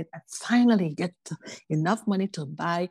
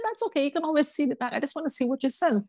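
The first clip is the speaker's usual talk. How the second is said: much higher, wheedling and insistent; pushy cousin; 9 semitones higher than usual. Retold or imitated. Woman mimicking cousin insisting on opening the box, high and wheedling. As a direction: imitate the cousin brushing off the seal. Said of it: "She said".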